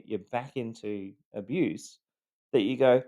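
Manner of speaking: ordinary talk, nothing marked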